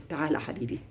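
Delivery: soft, low coaxing call; quiet fatherly coaxing; tender call. Retold or imitated. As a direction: gently call the child over. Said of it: "هيصون عيله".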